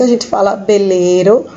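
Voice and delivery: "beleiro" dito com B no lugar do V; "veleiro" com som de B. Shown as a demonstration. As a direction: troque o V por B: "beleiro". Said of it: "o V tem som de B". (pronúncia espanhola: "beleiro" com B)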